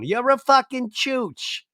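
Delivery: put-on high, slow childish voice; little girl's voice. Repeating the kid's curse for a ringtone, high and slow. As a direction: drawl it like a tiny kid cursing. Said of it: "I want that as my ringtone".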